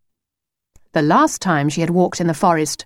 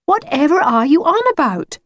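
put-on high, exasperated girl's voice; groaning; 8 semitones higher than usual. Lucy is baffled by the singing wolf, mildly groaning. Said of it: "groaned Lucy".

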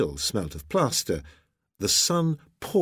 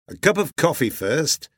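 louder, higher captain's voice; hearty sea captain, cheerful; 6 semitones higher, 5 dB louder than usual. A narrator's reading. Husband answering his wife at breakfast, hearty and loud. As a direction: play the jovial husband, loud and lifted.

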